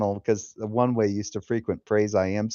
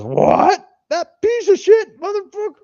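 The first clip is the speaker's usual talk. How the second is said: mock scream, much higher and louder; imitating wife screaming; 8 dB louder than usual. Imitating the evangelical's wife overhearing, mock scream. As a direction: ham up the furious wife yelling. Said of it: "And she goes".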